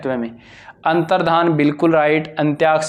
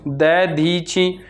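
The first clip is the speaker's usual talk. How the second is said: Dadhichi slowly, in drawn-out syllables; spelling demonstration; much slower than usual. Shown as a demonstration. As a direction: stretch Dadhichi as "da-dhee-chi". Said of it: "Dadhichi में भी same".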